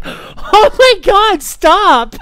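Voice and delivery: high, slow laughing "stop"; laughing plea. Retold or imitated. Watching a friend beaten in a box, laughing plea. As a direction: beg through laughter as the box gets hit. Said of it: "and I'm like".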